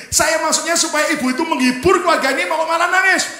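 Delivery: loud, high-pitched complaint; exasperated protest. Retold or imitated. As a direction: protest loudly that the plan backfired. Exasperated at the mother crying.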